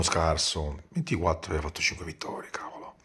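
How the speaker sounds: unvoiced whispered aside. Real, not acted. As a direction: whisper it to yourself. Father mutters about opponent.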